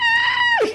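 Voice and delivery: very high shrieking laugh; mock scream. Viking raid skit, with a very high shriek. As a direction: shriek like a raided villager.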